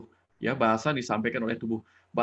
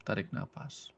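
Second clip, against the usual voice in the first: softer breathing cue; 3 semitones lower, 9 dB quieter, slower than usual. Shown as a demonstration.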